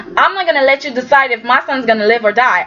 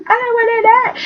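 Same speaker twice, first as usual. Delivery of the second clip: much higher, rising mock wail; high whiny fear; 12 semitones higher than usual. [name] scared for herself, high rising mock-frightened whine.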